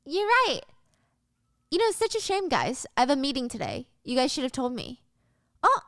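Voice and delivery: sing-song mock pity; fake sweet Stacy.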